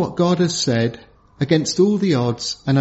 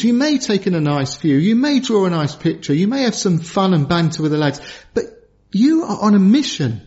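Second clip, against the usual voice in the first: normal talking voice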